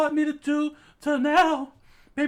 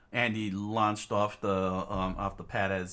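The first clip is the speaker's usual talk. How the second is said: story narration; 7 dB quieter than usual. A narrator's reading. Narrator describing the tearful classroom, low hushed storytelling.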